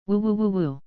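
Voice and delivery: muffled cries through taped mouth; muffled gagged cries. Acted. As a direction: moan through a gag, muffled. Gagged man in the trunk.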